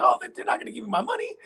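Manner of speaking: high, rushed imitation of a tearful dancer; hammed-up tearful complaint; mock crying. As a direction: whine it fast and high, as if weeping. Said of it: "she was crying".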